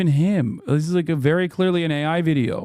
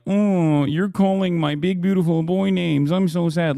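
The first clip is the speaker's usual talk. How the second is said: mocking whiny conservative; 3 semitones higher than usual. Streamer mocks Trump fans with a whiny sad voice. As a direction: whine it like a sulking child. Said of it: "they turn around and are like".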